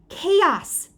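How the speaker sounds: loud, high, furious yell. A narrator's reading. Libby mad at Pete, loud high yell. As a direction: yell it in anger. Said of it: "she yelled".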